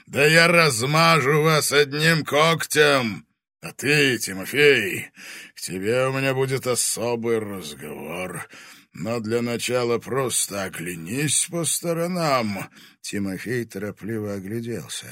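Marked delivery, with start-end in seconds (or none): 0.1-4.2 menacing fire dragon voice; booming arrogant dragon. Acted. Dragon threatens heroes. 4.2-5.1 Тимофей said loud by the dragon; Тимофей, called out in the booming dragon voice. Acted. Dragon addresses Timofey. 5.5-12.7 menacing fire dragon voice; booming arrogant dragon. Acted. Dragon threatens heroes.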